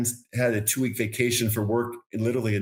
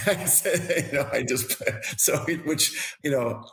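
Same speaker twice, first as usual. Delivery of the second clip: laughing through the words, higher pitch; 3 semitones higher, slower than usual. Real, not acted. Golfer laughing at his own helicopter joke to the host, laughing. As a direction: chuckle while trying to carry on.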